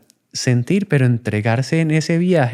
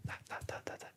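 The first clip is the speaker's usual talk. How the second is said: breathy ta ta ta, very soft like toy smashing; unvoiced little smashing taps; whispered breaking noises; 29 dB quieter than usual. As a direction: whisper the sound of breaking the box. Acting out breaking the box.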